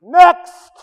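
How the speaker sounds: high, loud bark of "Next!"; barber's call. Retold or imitated. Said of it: "the great line of the barber".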